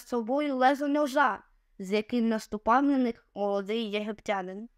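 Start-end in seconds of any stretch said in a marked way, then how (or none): none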